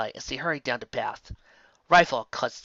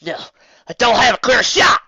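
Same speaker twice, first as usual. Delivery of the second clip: loud, higher frantic cry; 9 semitones higher, 10 dB louder than usual. A narrator's reading. Megan to herself, loud and frantic. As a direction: panic as the shot vanishes.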